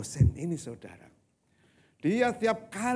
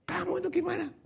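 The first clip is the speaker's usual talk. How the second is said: high-pitched, put-on outburst; mock angry scolding; faster than usual. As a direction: imitate a pastor flying off at squabblers. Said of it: "ngamuk kan".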